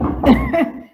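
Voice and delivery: sheepish laugh after choking; embarrassed laugh. Real, not acted. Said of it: "me ahogué". (risa avergonzada)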